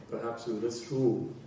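normal talking voice

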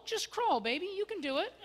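mock cheerleading. Husband mockingly cheering on his crawling wife.

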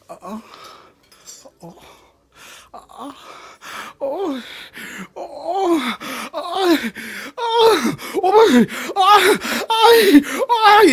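hammed-up moans rising to a loud cry; mock moaning.

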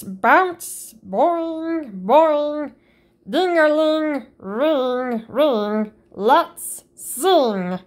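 plain reading voice

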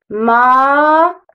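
ماء drawn out very slowly; slow syllable reading. Shown as a demonstration. Teacher sounds out the syllable slowly for children. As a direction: stretch the syllable ماء long. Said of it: "رددوا".

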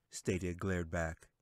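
ordinary delivery, nothing marked

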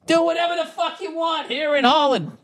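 high, far-off mocking voice squeezing up. Podcaster mocks Dutch listener's freedom in a high mock voice. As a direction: lampoon a carefree Dutchman in a high put-on voice.

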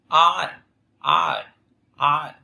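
æ made by sliding A quickly into E in "Ai"; A gliding into E. Shown as a demonstration. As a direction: say "Ai" as an open A closing fast to E. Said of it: "E nướt nhanh vào nhau". ("Ai" lướt nhanh từ A sang E)

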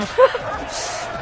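high breathless giggle; high squealing laugh. Real, not acted. Watching the brawl, gamer squeals with laughter. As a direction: squeal with glee.

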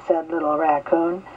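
usual speaking voice

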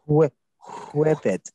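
"whip" with a forceful puffed h; attempted breathy WHIP. Shown as a demonstration. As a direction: push air out before the w in "whip". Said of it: "I can't pronounce it like you guys".

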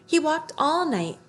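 ordinary delivery

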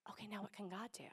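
soft unvoiced whisper; a hushed, hopeful question to God. Shown as a demonstration. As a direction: breathe it in wonder. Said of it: "and then say".

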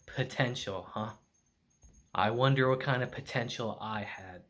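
low, softened inner musing; thinking to himself. A narrator's reading. Dying fighter wonders about his lost potential, low and reflective. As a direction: muse quietly, lightly voiced. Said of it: "As he thinks to himself".

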